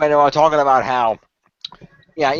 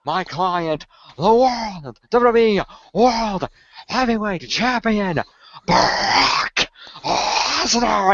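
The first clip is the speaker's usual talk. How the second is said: slow, grand ring announcer build, higher; Heyman introduction; 6 semitones higher, much slower than usual. Retold or imitated. Retelling Heyman introducing his client, grand slow build. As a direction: draw out every title like a hype man. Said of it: "and he goes".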